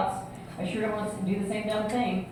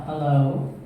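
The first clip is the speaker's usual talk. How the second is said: man answering; 7 semitones lower than usual. Retold or imitated. Husband picking up the phone, lower voice. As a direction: lower man's hello. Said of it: "this voice comes on".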